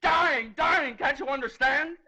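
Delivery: high, loud anguished cry; desperate outburst. Acted. Father making the judge grasp his baby's danger, desperate. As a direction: hurl each word out in desperation.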